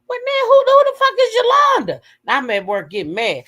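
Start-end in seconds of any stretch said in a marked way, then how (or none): 0.1-2.0 high, loud retold outburst of disbelief; baffled outburst. Host retelling reaction at work to viewers, baffled outburst. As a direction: shoot way up in exasperated confusion.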